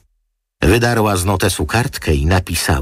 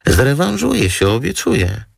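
much higher, pleading sweetly; wheedling model; 11 semitones higher than usual. Acted. Model pleading girl to girl for a favour, high and sweet. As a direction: beg her like a pouty girlfriend.

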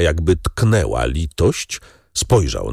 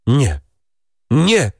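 screaming no; 6 semitones higher than usual. Acted. Cornered wife screaming at her husband in refusal. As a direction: scream it out in terror. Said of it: "wrzasnęła".

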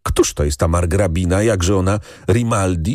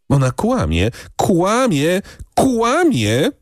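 each repeat climbs higher in pitch; mounting outrage, ever higher; escalating denial; 11 semitones higher, slower than usual. A narrator's reading. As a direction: insist indignantly, pitch rising to a high cry. Furious woman denies, rising each time.

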